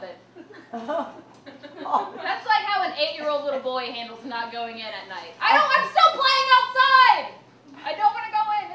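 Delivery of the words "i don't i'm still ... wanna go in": put-on loud, higher childish protest; whiny kid. Eight-year-old refusing to come in, loud. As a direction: whine like a boy called in.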